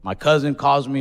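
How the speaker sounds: ordinary talk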